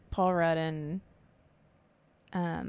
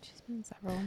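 whispering; 4 semitones higher, 7 dB quieter, faster than usual. Real, not acted. Whispers the reply.

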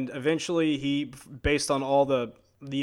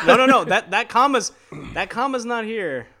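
excited; 7 semitones higher, 7 dB louder than usual. Real, not acted. Excited correction of joke.

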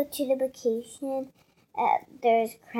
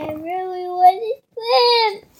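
whiny plea, louder and higher; pleading; 5 semitones higher, 8 dB louder than usual. Real, not acted. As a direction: beg Mommy with a rising whine. Child pleading with mother to swim.

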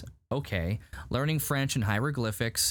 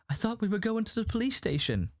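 higher-pitched, uneasy retelling; mock worried protest; 10 semitones higher than usual. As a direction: imitate a passenger getting nervous.

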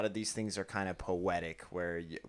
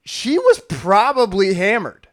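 louder and higher, laughing it out; laughing loudly; 9 semitones higher, 13 dB louder than usual. Real, not acted. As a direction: blurt it with a big laugh. Joking that Mary was drunk.

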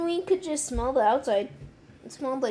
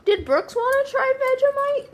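high, loud shout across the house; calling out; 11 semitones higher, 7 dB louder than usual. Real, not acted. Asking whether Brooks will join the tasting, called out loudly. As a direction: yell the question to someone far off.

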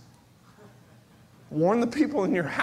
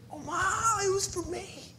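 tearful; 10 semitones higher, 6 dB quieter than usual. Real, not acted. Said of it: "overcome by Christ's sacrifice".

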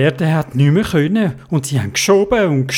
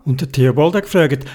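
usual speaking voice

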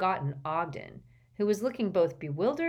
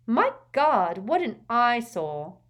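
put-on high, loud, slow young woman's exclamation; a haughty girl calling out; ringing girl's voice; 7 semitones higher, 10 dB louder, slower than usual. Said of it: "rang out a girl's voice".

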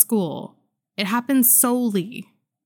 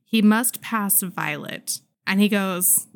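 normal talking voice

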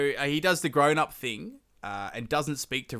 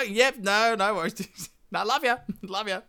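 high, sugary dismissive reply; mock sweet mother; 8 semitones higher than usual. Queen mother to Rama, high mock sweetness. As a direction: brush it off with fake sweetness.

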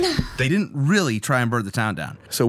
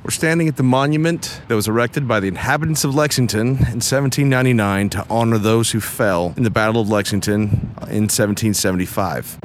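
ordinary delivery

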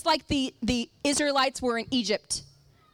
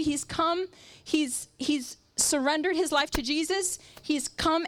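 normal talking voice

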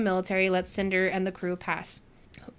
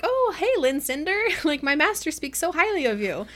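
high-pitched, chirpy retold greeting; light, gushing mimicry, pitched up; 6 semitones higher than usual. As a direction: greet them like an admiring fan. Retold girl greeting Cinder eagerly.

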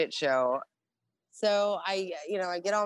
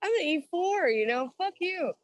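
much higher, laughing mock taunt; cocky drunk bravado; 8 semitones higher than usual. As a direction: gloat like a tipsy sailor off curfew. Retelling partying at A school.

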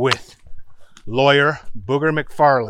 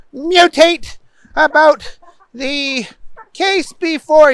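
pompous, bumbling Booger; 11 semitones higher, 4 dB louder than usual. A narrator's reading. Bumbling lawyer arguing his case to the judge, high and pompous. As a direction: plead grandly in a high put-on voice.

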